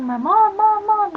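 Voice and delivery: high, loud repeated calls, acting out panic; retold scream for mom. Hurt kid calling mom from the stairs, acted scream. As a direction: yell for help up the stairs. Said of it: "I'm screaming".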